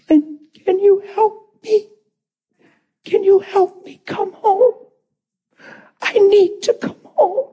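very high, slow crying voice; trembling tearful plea. Retold or imitated. Bill asking preacher to help him come home, crying. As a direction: plead haltingly through tears, imitating him.

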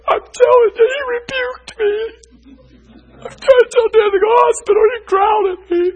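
high-pitched loud near-shout, half crying; high excited outcry. Retold or imitated. Preacher to congregation, retelling being rebuked, high excited outcry. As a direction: cry out in high excitement as if wronged.

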